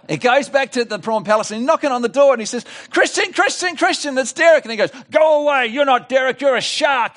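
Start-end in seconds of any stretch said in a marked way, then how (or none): 2.9-4.6 calling at the door. Acted. Derek calling at the door again. 5.1-7.2 much higher, louder frightened cry; scared prawn shouting through the door; scared refusal. Acted.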